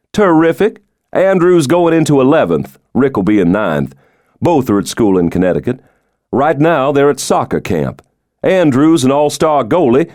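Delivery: teasing about his rich father, pitch lifted; lightly voiced president. Stoddard proud of his sons.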